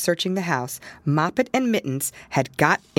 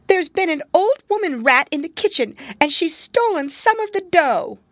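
high, loud, breathless kitten telling; a scared kitten blurting out the news; frightened kitten; 12 semitones higher, 5 dB louder than usual. A narrator's reading. Frightened kitten tells her mother about the rat, high and loud. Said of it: "She seemed to be in a terrible fright".

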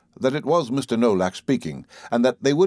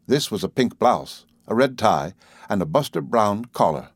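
plain reading voice

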